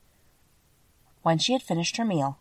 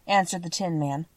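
ordinary delivery, nothing marked

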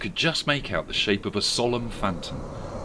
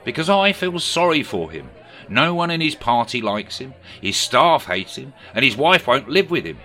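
raised, louder politician voice; Keir Starmer impression; 5 semitones higher, 6 dB louder than usual. A narrator's reading. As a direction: play Keir telling a story. Sir Keir voiced in story.

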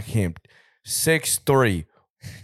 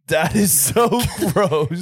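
high, slow, hammed-up "ew" voice; mock disgusted girl; 8 semitones higher, 4 dB louder, slower than usual. Imitating girls reacting to a fart, high and slow. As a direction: drag out the disgust like a grossed-out girl. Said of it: "they'd be like".

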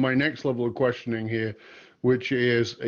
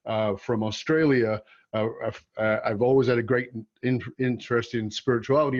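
ordinary talk, nothing marked

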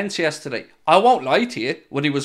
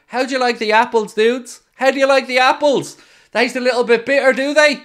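taunting; 7 semitones higher, 4 dB louder than usual. Real, not acted. Taunting journalists over Salah.

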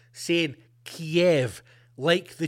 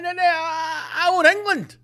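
mock whiny protest; 12 semitones higher than usual. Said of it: "You'd be like".

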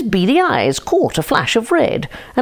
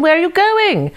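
calling after him; 12 semitones higher than usual. Acted. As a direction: call out to the running fox, faking puzzlement. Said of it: "cried the chicken".

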